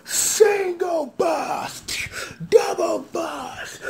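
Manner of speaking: high, slow, stretched shout; hyped callout. Retold or imitated. Recapping the rider loading up, shouted slow and high. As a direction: call each attack like an announcer. Calling out the loaded attack.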